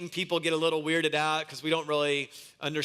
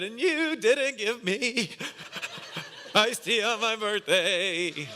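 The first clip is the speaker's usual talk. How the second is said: put-on crying little boy; 5 semitones higher, slower than usual. Preacher voices crying son.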